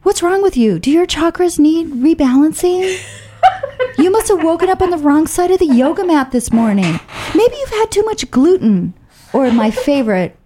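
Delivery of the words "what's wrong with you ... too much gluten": high, sarcastic jabs with laughter; raised, mocking taunt; mock insults. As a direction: deliver imagined comebacks gleefully. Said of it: "I could have said".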